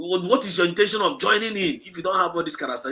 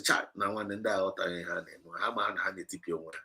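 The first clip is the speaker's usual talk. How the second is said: quoted leader's aside; 8 semitones lower, 10 dB quieter than usual. Retold or imitated. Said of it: "Ọ bụ ya ọsị".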